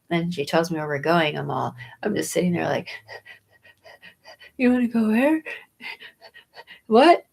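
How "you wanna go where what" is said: higher, louder shocked what; disbelieving. Retold or imitated. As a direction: ham up the disbelief. Retells shocked reply again.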